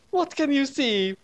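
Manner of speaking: high, slow squeaky Ray voice; high voiced Ray. A narrator's reading. Ray questions Naomi, high slow squeaky voice. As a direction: drag it out in a high silly voice.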